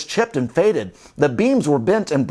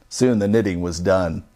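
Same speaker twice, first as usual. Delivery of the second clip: plain reading voice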